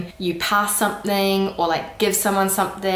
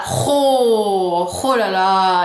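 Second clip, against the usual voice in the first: louder, slow throaty ho la la; annoyed ho la la; 4 dB louder, much slower than usual. Shown as a demonstration. Showing annoyance to learners. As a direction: growl then drag the la la. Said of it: "you know, like".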